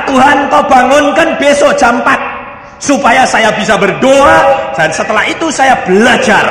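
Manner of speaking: doa lantang dengan nada tinggi; doa berseru. Retold or imitated. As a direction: minta kepada Tuhan dengan berseru. Doa anak yang diperagakan. (doa anak ditirukan dengan lantang)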